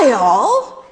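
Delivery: loud outraged holler. A narrator's reading. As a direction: bellow it in disbelief. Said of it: "Imogene hollered".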